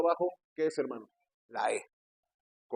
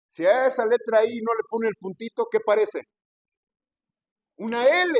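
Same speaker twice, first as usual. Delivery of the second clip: louder, higher preaching voice, driving each point; fiery preaching; 5 semitones higher, 5 dB louder than usual. Acted. Pastor hammers the point about every tilde, loud and high. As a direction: preach hard to the congregation.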